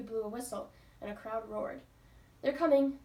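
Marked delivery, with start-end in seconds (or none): 2.4-3.0 loud, higher frightened cry; terrified cry. A narrator's reading. Daisy hears help on the stairs, frightened cry. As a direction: cry it out half panicked. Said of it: "cried equally terrified Daisy".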